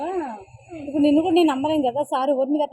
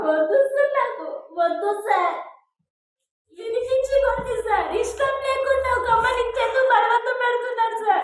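desperate protest; 9 semitones higher than usual. Acted. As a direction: push back in panic, voice cracking high. Employee resisting boss's pressure.

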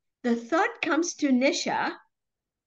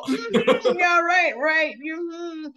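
loud, higher mock "yeah, right"; sarcastic dismissal; 5 semitones higher, 4 dB louder, slower than usual. Telling Tomo how to brush off a thought, loud scoff. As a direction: scoff at the thought as if it's nonsense. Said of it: "you go".

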